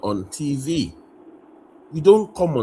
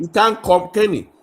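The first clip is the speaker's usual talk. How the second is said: loud, high insistence cutting in; heated objection to the host; raised voice; 8 semitones higher, 8 dB louder than usual. Real, not acted. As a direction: push back hard over the others. Heated argument over TV claims.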